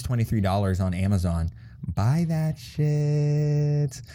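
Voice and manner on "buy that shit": very slow, higher sing-out; drawn-out plug. Real, not acted. Cookbook host urging listeners to buy, drawn-out. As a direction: stretch every word out long and high. Plug after naming the price.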